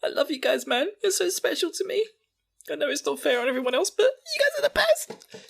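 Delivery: high, squeezed mock-emotional voice; Connie. Connie hugging Sasha and Jean, high gushing affection. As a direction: gush in a high, tearful put-on voice. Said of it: "gives Sasha and Jean big hug".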